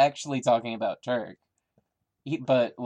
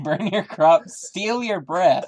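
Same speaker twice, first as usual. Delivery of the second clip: higher, much louder, slow threat; wild unhinged ranting; 6 semitones higher, 9 dB louder, slower than usual. Acted. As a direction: bellow it like a mad villain. Friend repeats threat dramatically.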